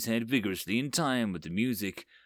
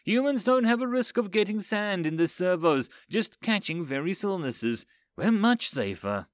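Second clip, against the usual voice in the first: chirpy, higher-pitched reasoning; cheerful; 8 semitones higher than usual. A narrator's reading. Biologic explains humans' advantages to Overseer, cheerfully. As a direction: pipe up brightly with the explanation. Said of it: "said also cheerfully".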